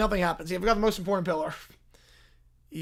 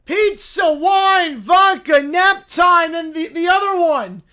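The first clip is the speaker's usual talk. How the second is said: loud, shouted list of pillars; 8 semitones higher, 12 dB louder, slower than usual. Acted. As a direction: bellow each pillar. Bear shouts his pillars.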